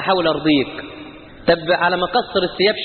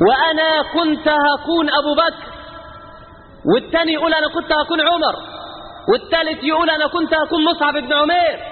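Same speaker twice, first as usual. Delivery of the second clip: mock boast; 7 semitones higher than usual. Mocking boastful listeners' claims.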